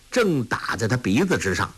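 plain reading voice

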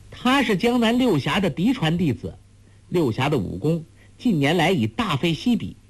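put-on high, lilting voice of a cheeky girl; taunting girl. A narrator's reading. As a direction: goad her with lofty, high-pitched reproach.

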